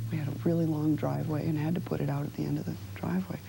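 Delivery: usual speaking voice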